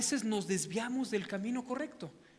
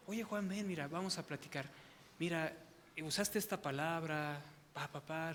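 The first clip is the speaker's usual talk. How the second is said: gentle mock pastor; 2 semitones lower, 7 dB quieter than usual. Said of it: "Me hubiera dicho".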